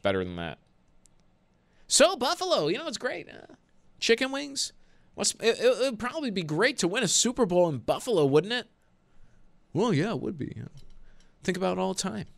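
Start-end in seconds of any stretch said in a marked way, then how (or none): 1.9-8.7 much higher, sing-song reporter parody; mock bland interviewer. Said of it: "what would you rather hear?". 9.7-12.3 lightly voiced dull quarterback answer; mock bland athlete. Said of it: "what would you rather hear?".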